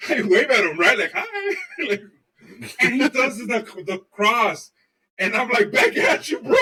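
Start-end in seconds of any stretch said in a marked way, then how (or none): 1.1-1.5 falsetto hi. Retold or imitated. Waving at priest. 5.7-6.5 mock shout. Answering priest's cross.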